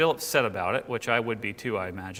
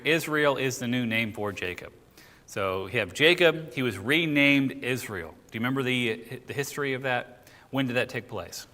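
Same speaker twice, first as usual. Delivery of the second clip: ordinary delivery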